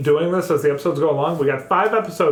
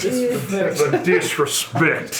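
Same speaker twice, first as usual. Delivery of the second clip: mock dramatic; much slower than usual.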